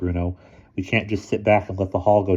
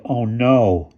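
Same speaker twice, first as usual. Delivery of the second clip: groaning; 4 semitones higher, 5 dB louder than usual. A narrator's reading. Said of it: "groaned Bruno".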